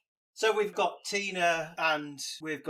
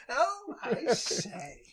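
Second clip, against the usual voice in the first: much higher, slow, laughing posh English; posh exclamation; 9 semitones higher, 4 dB quieter, much slower than usual. Pretend. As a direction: drawl it like a fop.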